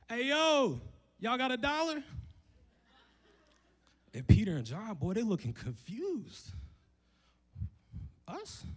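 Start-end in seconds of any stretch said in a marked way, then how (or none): 0.1-2.0 high, loud street-corner ask; hammed-up beggar begging for a dollar; squeaky loud hey yo plea; mock polite beggar. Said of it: "so politely is like". 8.3-8.7 high squeaky us; startled disbelief; squeaked mock surprise; surprised. Apostles acting confused.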